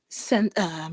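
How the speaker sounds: ordinary talk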